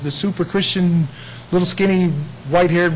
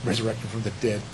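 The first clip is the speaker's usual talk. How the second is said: scoffing; 10 semitones lower, 10 dB quieter than usual. Retold or imitated. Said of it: "from those that said".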